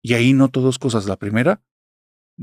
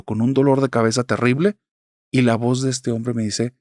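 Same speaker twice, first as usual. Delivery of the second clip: plain reading voice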